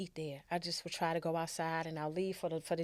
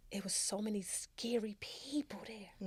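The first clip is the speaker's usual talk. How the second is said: hushed whisper; 3 semitones higher, 8 dB quieter than usual. Real, not acted. Whispering about the shelter.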